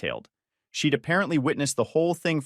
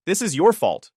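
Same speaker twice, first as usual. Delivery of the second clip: accusing; 5 semitones higher, 5 dB louder than usual. A narrator's reading. Dorian blames the narrator.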